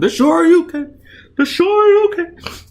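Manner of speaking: high, loud hype shout, last word drawn out; hyped call. Real, not acted. Creator hypes the finishing uppercut in his own comic. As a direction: call the move like a fan losing it.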